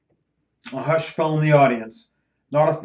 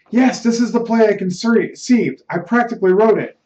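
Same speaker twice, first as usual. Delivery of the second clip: higher, eager protest; agitated insistence; 6 semitones higher, 3 dB louder than usual. Acted. Ernest insisting to his doubting friend that he wrote it, agitated. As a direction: push back hard, worked up.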